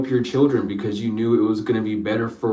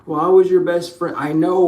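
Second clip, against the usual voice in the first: pleading ex-partner; 7 semitones higher, 6 dB louder than usual. Acted. Pleading voiced other person.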